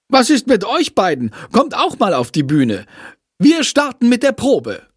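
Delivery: high, sharp teacher's scolding; stressed teacher scolding two pupils. Acted. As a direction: call the dawdlers onto the stage. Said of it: "schimpft Frau Schmidt".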